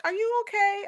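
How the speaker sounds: a hint of a worried nurse asking; concerned nurse. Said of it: "the lady's like".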